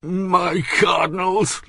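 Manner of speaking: high, slow grumble rising in protest; grumbling. A narrator's reading. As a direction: drag out the first grumble. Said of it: "grumbled Bouville".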